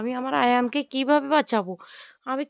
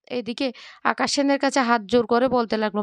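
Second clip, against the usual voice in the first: plain reading voice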